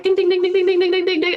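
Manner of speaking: high, rapid ding ding imitation; phone notification dings. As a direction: sing out the phone pings, high and bright. Imitating her phone going off.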